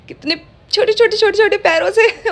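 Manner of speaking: adoring squeal at the kitten's little feet; cutesy gushing. Real, not acted. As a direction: coo it like talking to a kitten. Gushing over kitten's tiny paws.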